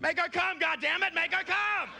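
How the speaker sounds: high-pitched angry neighbor yelling; pissed off. Acted. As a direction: holler like a fed-up neighbor. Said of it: "pissed off".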